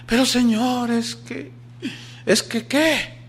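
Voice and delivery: a nervous private stalling; protesting soldier. Retold or imitated. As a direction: soldier balks at the order, stammering high. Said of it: "reluctant soldier voiced".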